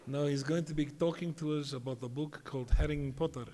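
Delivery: a hint of a doubtful old rabbi introducing. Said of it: "he gave me the introduction from hell".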